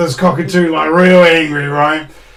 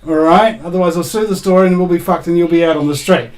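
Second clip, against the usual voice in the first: ordinary delivery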